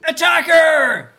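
high, loud cry of alarm; alarmed shout. Acted. Wounded merchant to his party, alarmed shouting.

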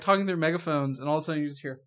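ordinary delivery

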